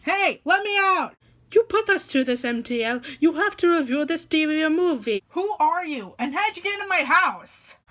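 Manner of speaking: trapped reviewer yelling from the closet; panicked shouting. Acted. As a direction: bang on the door and shout.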